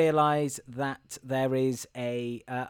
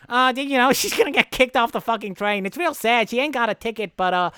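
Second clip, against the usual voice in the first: put-on high-pitched, pushy know-it-all kid; irritating little tattletale voice; annoying boy; 6 semitones higher, 4 dB louder than usual. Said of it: "the annoying boy goes".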